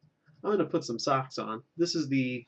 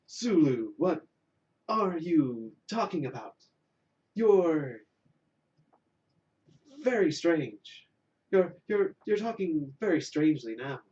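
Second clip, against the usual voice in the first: Kirk done higher and louder, not quite right; puzzled captain, slightly raised and emphatic; bad Kirk impression; 4 semitones higher, 3 dB louder than usual. Host as Kirk questions Sulu, higher and louder. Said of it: "I'm not doing my Kirk properly".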